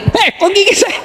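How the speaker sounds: high, squealing cry of pain; mock pained mother. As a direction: wince like a mother bitten while nursing. Baby teething, mother's cry. (ibu yang kesakitan, pura-pura menjerit tinggi)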